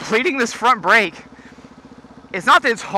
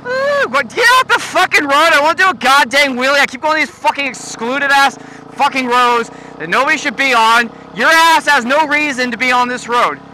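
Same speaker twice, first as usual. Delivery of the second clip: annoyed at driver; 6 semitones higher, 4 dB louder than usual. Real, not acted. Yelling at a driver.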